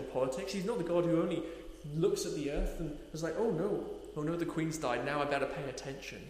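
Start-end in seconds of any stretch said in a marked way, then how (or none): none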